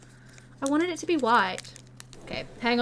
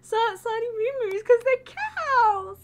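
excited squeal; 7 semitones higher, slower than usual. Real, not acted. Squealing over cow candy.

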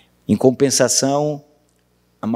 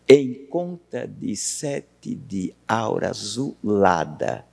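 very slow, separated syllables; syllable-by-syllable scansion; 5 semitones lower, 4 dB quieter, much slower than usual. Shown as a demonstration. Teacher scanning the second line for the class, slow scansion. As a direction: measure out "Em conta de sete de aura azulada" syllable by syllable. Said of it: "versos de quantas sílabas?".

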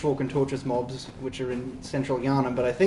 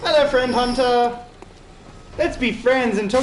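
bright, loud, high friendly hail; cheery loud greeting; 10 semitones higher, 7 dB louder than usual. Real, not acted.

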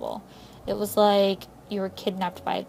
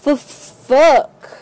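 sudden loud swear, higher; loud curse; 6 semitones higher, 13 dB louder than usual. Real, not acted. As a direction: blurt it out loudly. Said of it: "Okay, fuck".

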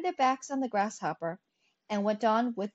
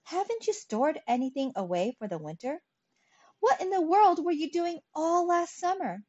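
incredulous questioning, climbing higher in pitch; surprised; 7 semitones higher than usual. A narrator's reading. Ants questioning the starving grasshopper, astonished. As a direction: scold in rising disbelief. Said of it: "cried the ants in surprise".

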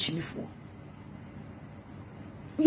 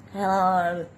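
fake American accent. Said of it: "fake American accent".

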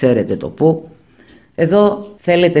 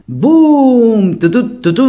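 loud, high mock trumpet boom, then toots; playful trumpet fanfare; trumpet blast imitation; 9 semitones higher, 5 dB louder, much slower than usual. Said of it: "Μία τρομπέτα".

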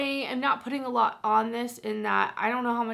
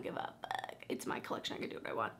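hushed, breathy, just for herself; whispered defiant aside; 13 dB quieter, faster than usual. Real, not acted. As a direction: whisper it like a cheeky confession.

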